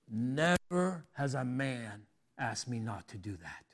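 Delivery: low, quiet, grave retelling; understated sternness; stern and serious. Retold or imitated. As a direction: lightly imitate a stern man, kept low and soft. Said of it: "all stern, serious face".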